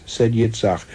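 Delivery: plain reading voice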